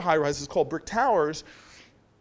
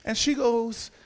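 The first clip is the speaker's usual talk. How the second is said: ordinary talk, nothing marked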